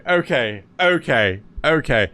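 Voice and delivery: usual speaking voice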